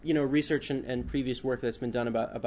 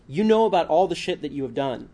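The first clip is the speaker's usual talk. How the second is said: louder, each word bitten off; biting staccato; 4 semitones higher, 8 dB louder than usual. A narrator's reading. Man accusing the journalist in his office, biting staccato. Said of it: "biting each word with a staccato tone".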